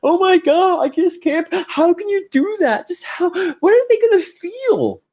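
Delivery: high, whiny mock outrage at the viewer; mocking outraged viewer. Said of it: "how dare you talk like this?".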